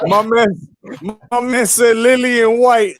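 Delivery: high, loud, drawn-out laughing words; cracking up. Real, not acted. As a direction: squeal it out through real laughter. Cracking up at a joke name.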